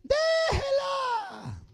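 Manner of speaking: very high, long-held call; yelling from inside. Acted. Household yells from indoors at a chicken thief, high holler. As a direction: hold the word out as a high holler. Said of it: "van a gritar con Naín".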